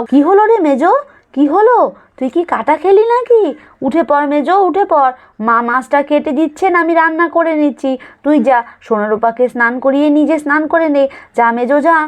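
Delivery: urgent, caring questions; concerned eldest daughter-in-law. Acted.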